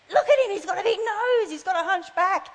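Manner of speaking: high, anxious hushed squeal; mock alarm. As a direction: voice climbs as imagination runs wild. Acting panic at a shadowy figure.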